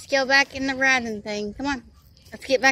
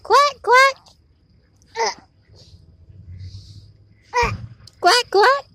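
high, loud quacking duck call; 11 semitones higher, 5 dB louder, slower than usual. Acted. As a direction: quack at the ducks across the water. Quacking at the ducks.

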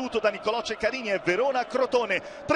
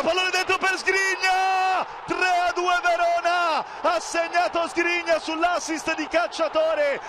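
breathless italian goal commentary; 4 semitones higher than usual. Real, not acted. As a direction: drag out the winning goal call. Go-ahead goal, shouted slowly.

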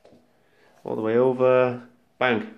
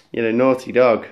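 normal talking voice

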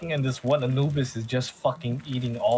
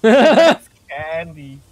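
drawn-out exclaim; 3 semitones higher, 14 dB louder, much slower than usual. Real, not acted. Playful argument about drinks.

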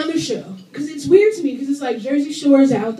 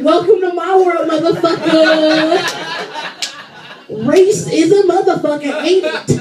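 loud, higher mock gloating; gleeful gloating; 4 semitones higher, 5 dB louder than usual. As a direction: crow it with Grinch-like glee, stretching the last word. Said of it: "smiling like the fucking Grinch".

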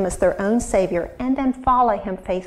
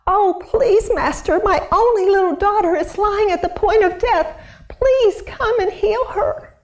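high, pleading cry of a father; father begging Jesus for help; desperate father; 10 semitones higher than usual. Acted. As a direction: beg for your dying daughter.

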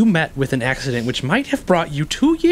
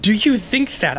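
affronted challenge; 6 semitones higher than usual. Acted. Called a coward, affronted.

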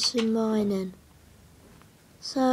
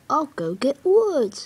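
ordinary talk, nothing marked

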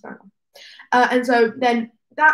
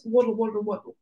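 ordinary talk, nothing marked

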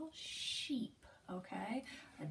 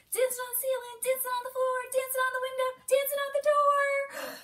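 high, loud and racing; even faster; 12 semitones higher, 9 dB louder, much faster than usual. Shown as a demonstration. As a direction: go wild, faster and higher. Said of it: "Even faster".

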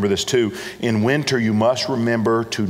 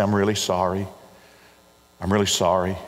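soft, lower "I'm really sorry", repeated gently; quiet condolence; 4 semitones lower than usual. Shown as a demonstration. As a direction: console someone who lost a loved one. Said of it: "I just say".

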